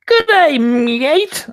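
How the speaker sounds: high, loud, badly done Aussie greeting; terrible Australian accent. Father imitating his son's greeting, terrible Australian accent. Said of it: "That's a terrible Australian accent".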